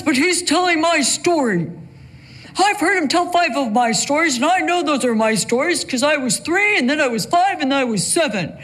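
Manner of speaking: a mild take on dad griping with a chuckle; Chuck impression. Dad complaining about a radio pastor retelling his stories, lightly amused. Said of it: "I'll never forget my dad saying".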